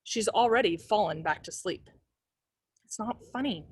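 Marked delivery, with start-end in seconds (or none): 2.8-3.7 "It's not funny" whispered much softer into the dark. A narrator's reading. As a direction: breathe the words out alone in the dark. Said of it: "whispering the words into the darkness".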